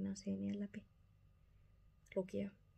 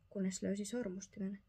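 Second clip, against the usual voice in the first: ordinary delivery, nothing marked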